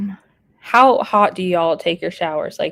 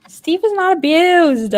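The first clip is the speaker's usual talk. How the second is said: much higher, slow, playful protest; mock-innocent high voice; put-on high voice; 10 semitones higher, 6 dB louder than usual. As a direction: squeak it out like a cartoon denial. Answers chat in put-on voice.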